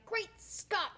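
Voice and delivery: high-pitched imitation, no real volume; mock catchphrase shout. Sister imitating Bobby's movie quoting, high mock shout. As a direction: mimic an exclamation in a high, put-on voice. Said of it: "he won't stop shouting".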